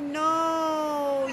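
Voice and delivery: ordinary delivery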